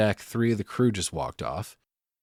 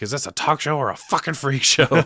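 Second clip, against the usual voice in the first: loud mocking jab; scornful heckle; 5 semitones higher, 5 dB louder than usual. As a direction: sneer it at the host. [name] heckles the host.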